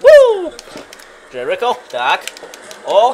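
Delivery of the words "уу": high loud "woo" like the crowd; crowd woo imitation. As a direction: yell the Flair woo. Crowd "woo" after chop.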